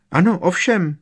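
high, loud, emphatic yes; vehement house-elf. A narrator's reading. Winky confirms it to Harry, high and vehement. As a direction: agree fiercely in a high voice. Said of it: "přikyvovala zuřivě Winky".

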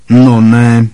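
loud sudden hoot; hooting exclamation. A narrator's reading. Aunt Marge noticing Harry, loud hoot. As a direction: bark it out, surprised.

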